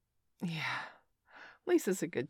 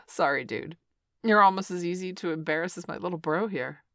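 usual speaking voice

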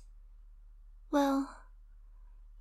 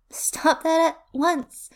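slow and firm, a touch louder; stern, slow scolding; 2 semitones higher, 4 dB louder, much slower than usual. Acted. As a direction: stretch each word out like a strict nurse. Scolding his wandering hands.

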